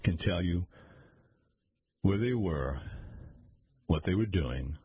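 even, measured reading voice with spoken quote marks; story narration. A narrator's reading. Reader narrating a mystery story to listeners, steady storytelling. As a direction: read it like an audiobook narrator.

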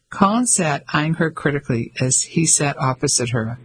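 plain reading voice

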